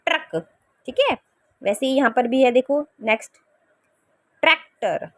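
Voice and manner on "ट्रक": ट्रक, said softly with ट्र joined; softer truck. Shown as a demonstration. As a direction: repeat ट्रक, gently. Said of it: "कैसे बोलते हैं इसको?". (ट्रक धीमे से दोहराएँ)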